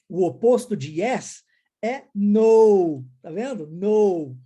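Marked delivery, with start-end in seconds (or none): 2.1-3.0 NO much louder, held long as "nou"; pronunciation of NO. Shown as a demonstration. Teacher giving the opposite of yes, emphatic model NO. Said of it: "a mesma pronúncia, o mesmo som". 3.7-4.4 NO louder, stretched as "nou"; pronunciation of NO. Shown as a demonstration. Teacher asking if students see it, emphatic model NO. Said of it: "a mesma pronúncia, o mesmo som".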